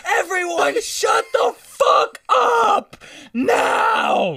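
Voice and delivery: high, slow laughing mock yell; hammed-up shout through laughter. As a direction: bark orders at a car full of panicking people. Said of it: "[name] raised his voice".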